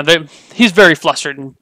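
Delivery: normal talking voice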